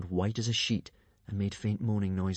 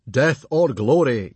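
slow, loud battle cry; yelling; 3 semitones higher, 9 dB louder, slower than usual. A narrator's reading. Chief rallies the trainees for the quest, yelling slowly. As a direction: bellow it to rouse the tribe. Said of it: "yelled Stoic the Vast".

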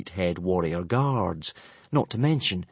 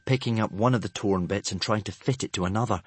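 ordinary delivery

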